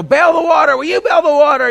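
high, loud frantic yell to bail; a sailor yelling at the crew to bail water in the storm, frantic shout; panicked shout. Retold or imitated. Desperate high-pitched shouting order. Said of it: "somebody saying".

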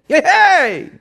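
high loud shouting hey; booming call; God calling out. Retold or imitated.

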